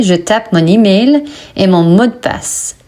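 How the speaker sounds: usual speaking voice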